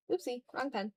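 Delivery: usual speaking voice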